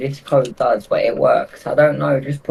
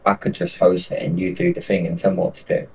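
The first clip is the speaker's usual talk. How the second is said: ordinary delivery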